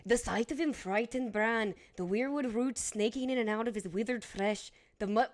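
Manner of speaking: British accent reading. Shown as a demonstration. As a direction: read it like a fantasy audiobook, lightly British. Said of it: "give us a reading".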